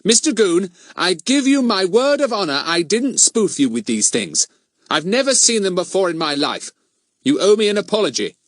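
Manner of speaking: high, loud, wounded protest; affronted schoolboy standing on his dignity; indignant dignity. A narrator's reading. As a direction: insist on your honour. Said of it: "trying to look as dignified as he could".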